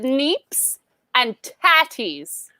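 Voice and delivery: "neeps" and "tatties" drawn out, each set apart; slow, emphatic repeat. Acted. Host repeats "neeps and tatties" for the hearing impaired, slow and emphatic. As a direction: spell it out slowly, pausing around "neeps" and "tatties". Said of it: "For those of you hearing impaired".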